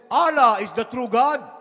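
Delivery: loud, high insistent claim; arguing. Acted. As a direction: assert it hard over your rivals. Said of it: "they were arguing".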